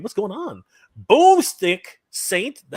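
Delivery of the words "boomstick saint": drawn out high and louder, savoring each word; relishing the name. Real, not acted. Host reading out a viewer's name he loves, drawn out with relish. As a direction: stretch "Boomstick Saint" slowly like a name you love. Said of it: "I love that name".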